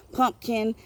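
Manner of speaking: usual speaking voice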